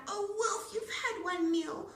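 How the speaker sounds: high, loud squealing pig; a piglet begging for its life; pig pleading. A narrator's reading. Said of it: "He cried".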